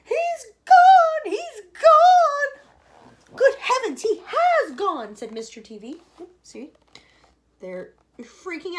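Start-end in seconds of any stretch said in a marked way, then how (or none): 0.0-3.3 very high, loud scream. A narrator's reading. Mother screaming where her son vanished. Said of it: "she screamed". 3.3-5.2 high, loud, slowing; shocked shout. A narrator's reading. Father shouting in shock at vanished son.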